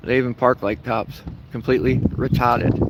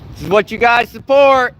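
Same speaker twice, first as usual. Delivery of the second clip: raised, higher protest shouting; heckling the people inside, loud and high; calling out; 8 semitones higher, 5 dB louder than usual. Real, not acted. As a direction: call it out across the lot. Shouting at office staff.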